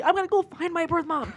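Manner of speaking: eager movie heroine. Retold or imitated. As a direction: gently parody a hopeful film character. Mimics starry-eyed movie heroine.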